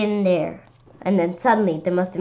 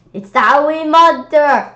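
higher, louder frightened cry; scared cry; 7 semitones higher, 8 dB louder than usual. A narrator's reading. Kids crying out at the monster in fright. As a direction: shout the alarm in fear. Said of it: "We all screamed".

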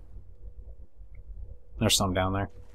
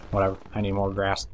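ordinary delivery

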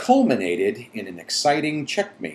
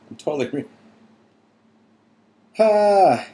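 usual speaking voice

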